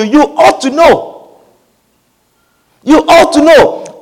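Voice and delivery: ordinary talk, nothing marked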